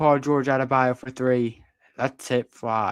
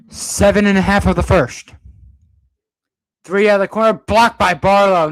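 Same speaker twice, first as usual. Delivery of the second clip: raised, projected play-by-play; high-energy commentator voice; loud live call; 5 semitones higher, 9 dB louder than usual. Real, not acted. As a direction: punch it out over the arena. Calling clock, then a block.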